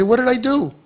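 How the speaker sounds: high-pitched imitation of defensive denial; high, put-on plea of innocence; mock innocent protest. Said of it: "People reject and they say".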